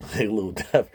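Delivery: ordinary talk, nothing marked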